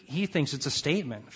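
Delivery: normal talking voice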